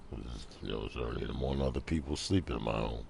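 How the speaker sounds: low, hushed early-morning voice; soft and deep, under your breath; talking low. Real, not acted. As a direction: keep it down for people sleeping. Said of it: "I'm talking low".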